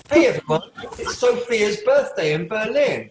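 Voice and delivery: high, loud call to the group; excited announcement. Real, not acted. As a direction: share the good news, laughing at the end. Announces birthday to whole class.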